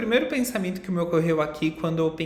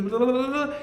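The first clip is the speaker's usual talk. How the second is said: high, louder mocking babble; mocking blah blah; 9 semitones higher, 4 dB louder than usual. Teacher mocks the mind's chatter with high blah blah. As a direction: brush it off as endless chatter. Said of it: "Ela fica dizendo ali o tempo inteiro".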